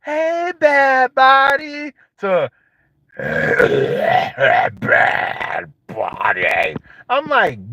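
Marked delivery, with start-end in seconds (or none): 0.0-1.9 higher, slow, lilting greeting; sing-song hello; sweet old voice. Retold or imitated. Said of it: "they'll be like". 3.2-6.7 very low, voiceless rasping shout; croaking smoker yell; raspy smoker voice. Retold or imitated. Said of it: "they'll be like".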